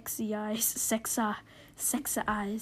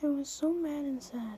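exaggerated mad and sad; 5 semitones higher than usual. Reader hamming up a sad fanfiction line, overdone misery. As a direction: overplay the sorrow in a raised voice. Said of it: "softer with your voice".

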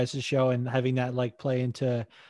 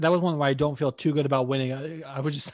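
ordinary talk

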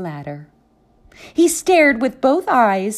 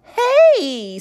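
sharp, higher, louder hey; annoyed call; 11 semitones higher, 4 dB louder than usual. Acted. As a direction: snap it out, woken books. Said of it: "said the others".

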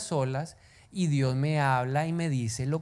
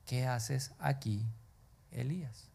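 soft, lower, slow whisper-like; quiet voice; 4 semitones lower, 10 dB quieter, slower than usual. A narrator's reading. God's voice questioning Elías in a soft whisper. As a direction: speak it gently like a still small voice. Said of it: "una voz le dijo".

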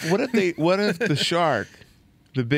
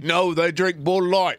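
higher mock-Aussie comeback; badly faked Australian twang, near laughter; hammed-up Australian, played for laughs; Australian accent; 4 semitones higher than usual. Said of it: "I gotta work on my Australian accent".

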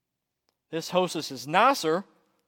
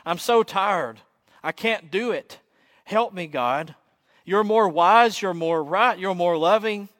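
raised, strained plea to God, lightly tearful; acted tearful plea; 4 semitones higher, 5 dB louder than usual. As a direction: plead wearily, a touch of sobbing. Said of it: "mumble through the sobs".

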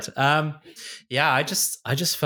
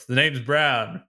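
a hint of suave spy voice; lightly voiced Bond catchphrase; James Bond line; slower than usual. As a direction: deliver it like a smooth secret agent. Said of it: "Bond, James Bond".

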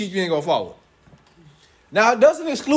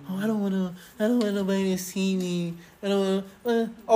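put-on shy kid; 6 dB quieter than usual. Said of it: "The kids, they always embarrassed".

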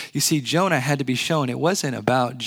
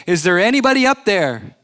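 calling out; 5 semitones higher, 5 dB louder than usual. Pretend. Imagined shouting to the sky.

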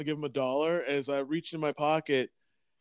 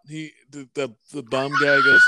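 usual speaking voice

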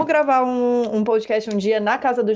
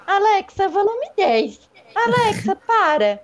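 high, loud commands to Alexa; bossy teacher voice; 6 semitones higher, 3 dB louder than usual. Retold or imitated. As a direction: bark orders at the smart speaker. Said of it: "a professora tava dando aula de, de aeróbico lá ... junto com a Alexa".

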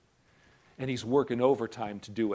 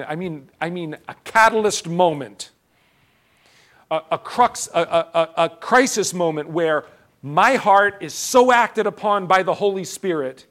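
rising intensity; 5 semitones higher, 8 dB louder than usual. Real, not acted. Preacher working up intensity.

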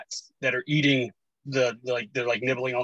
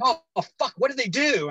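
high-pitched kid voice, mock dismay; imitated cursing child; 10 semitones higher than usual. Dad imitates daughter overheard in the other room, dismayed. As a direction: pitch it way up as a swearing little girl.

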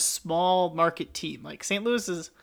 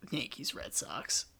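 whispered answer; 3 semitones lower, 12 dB quieter, slower than usual. Real, not acted. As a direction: whisper it, slow and soft.

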